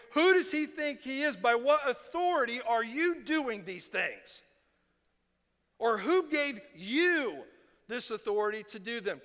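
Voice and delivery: high, loud indignant challenge; confrontational tone. Acted. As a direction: demand it like an offended elder. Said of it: "you can hear this tone".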